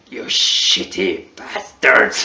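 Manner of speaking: low, unvoiced Mongolian-accented growl; mock the heavy Mongolian accent under your breath. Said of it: "Mongolian accent is like".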